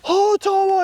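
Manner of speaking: high, delighted hail; excited greeting. Retold or imitated. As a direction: call out to a friend running down. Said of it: "I'm all".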